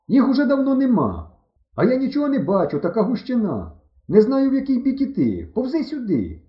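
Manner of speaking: high-pitched, exasperated hollering; shouting back. A narrator's reading. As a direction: yell across the cornfield to a friend. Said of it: "кричу я". (кричить високим голосом)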